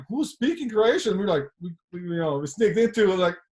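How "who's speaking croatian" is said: surprised. Retold or imitated. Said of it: "And we were like".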